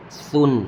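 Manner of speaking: light dental lisped s opening سن; careful Arabic tha in سن; sound of sa. Shown as a demonstration. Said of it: "سا کی آواز آئے گی".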